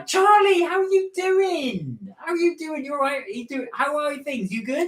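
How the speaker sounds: high, sing-song hello; mock greeting. Host greets Charlie in a high sing-song. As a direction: greet them in a big sugary voice.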